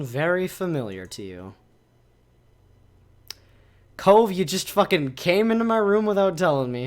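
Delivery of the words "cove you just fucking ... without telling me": loud, higher, startled exclamation; surprised outburst. Real, not acted. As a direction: blurt it out in shock. Character suddenly appears in room.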